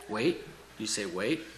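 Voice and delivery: understated indignant question, rising on wait. A narrator's reading. Praying believer questioning the master's answer, mildly indignant. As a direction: question it with quiet indignation, voice lifting at each end. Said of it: "my indignant reply".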